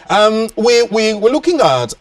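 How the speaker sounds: speaking up over a faint phone line. Real, not acted. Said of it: "really elevate my voice".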